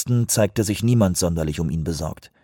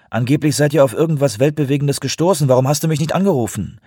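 higher, louder, quickening complaint; annoyed reproach; 7 semitones higher, 5 dB louder, faster than usual. A narrator's reading. Caller confronting colleague for not phoning him, annoyed. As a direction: press him crossly for an explanation.

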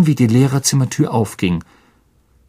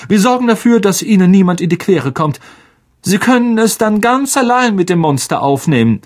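raised, pointed mock politeness; ironic McGonagall; 9 semitones higher than usual. A narrator's reading. McGonagall handing Lockhart the task, ironic and cutting. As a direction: serve the challenge with cutting sweetness.

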